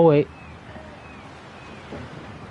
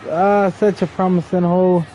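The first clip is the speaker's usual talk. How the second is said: normal talking voice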